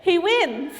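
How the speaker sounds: loud, laughing triumph; laughing reveal. Real, not acted. Telling the class the boy wins, loud and laughing. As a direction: burst out with the happy ending.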